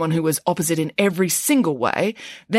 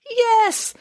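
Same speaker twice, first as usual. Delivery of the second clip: much higher grand yes; declaring. A narrator's reading. Nanny Piggins declares to Michael, high and grand. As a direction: announce it proudly. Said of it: "declared Nanny Piggins".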